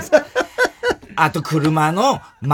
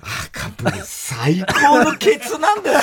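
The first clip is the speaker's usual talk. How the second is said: mock raving; 11 semitones higher than usual. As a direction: imitate a man raving, pitched way up and stretched out. Retold husband raving about his wife. (高い声で伸ばして絶賛する真似)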